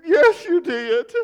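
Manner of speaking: hammed-up tearful wail, very high and loud; mock sobbing. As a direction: imitate a wounded old lady's drawn-out wail.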